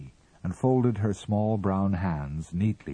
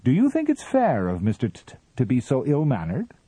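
high, loud schoolmaster tone; lecturing; 7 semitones higher, 5 dB louder than usual. A narrator's reading. Mister Ah Ah lectures the captain, high and prim. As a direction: lecture him like a prim teacher. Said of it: "lectured Mister Ah Ah".

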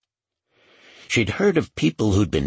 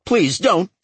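high, loud, sudden cry of protest; blurted plea; 13 semitones higher, 5 dB louder than usual. A narrator's reading. Douglas to the woman with the pistol, blurted high plea. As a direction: blurt it out, pleading, pitch jumping up. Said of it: "Douglas blurted".

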